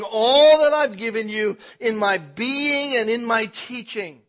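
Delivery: loud, high, driving sermon climax; full-force preaching. Acted. Preacher pressing the church, loud and rising.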